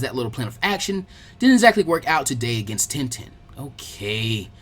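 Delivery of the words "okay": begrudging. Acted. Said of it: "he said begrudgingly".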